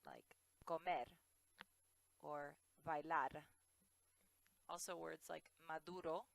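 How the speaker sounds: para, comer, bailar with a light flapped R; tapped Spanish R. Shown as a demonstration. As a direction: flap each R like the D in ladder. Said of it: "sounds like an English D".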